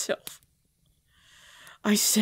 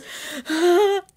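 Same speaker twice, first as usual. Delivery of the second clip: frenzied laugh; 8 semitones higher, 8 dB louder than usual. Acted. Hunger overwhelming her.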